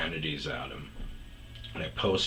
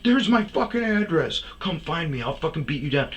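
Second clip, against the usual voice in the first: much higher, louder mock tough-guy threat; mock unhinged threat; 10 semitones higher, 4 dB louder than usual. Mocking the Boston guy's threatening message, in a much higher, hammed-up voice. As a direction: ham up a furious online threat. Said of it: "like, totally unhinged".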